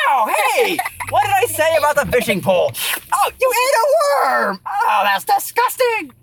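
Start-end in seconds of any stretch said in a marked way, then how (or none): 3.1-6.1 disgusted surprise. Real, not acted. Zombie eats a worm.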